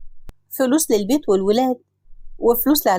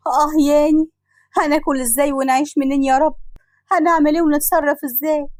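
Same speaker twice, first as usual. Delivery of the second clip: higher-pitched acted wail of worry; distressed lament; 5 semitones higher than usual. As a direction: lament helplessly, voice raised in pitch. نوشة تولول بعد إصابة زوجها. (ولولة بقلق وحسرة)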